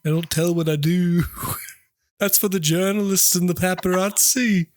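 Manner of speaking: lightly held put-on high singer voice; Walk Hard impression. Host teasing the guest, in a high put-on voice. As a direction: stay in the Walk Hard character voice. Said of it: "your impression is so, uh, walk hard".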